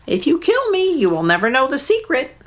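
a bird bargaining for her life; mud hen. Acted. Mud hen bargaining with Maui, high bird voice.

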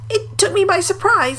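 a small frightened animal getting the words out; nervous piglet. A narrator's reading. Little pig still shaken after a fall, nervous squeak. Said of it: "said Piglet nervously".

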